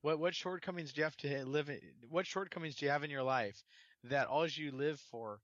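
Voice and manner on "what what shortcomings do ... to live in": ordinary talk, nothing marked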